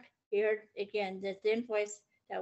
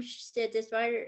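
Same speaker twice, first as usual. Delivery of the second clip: plain reading voice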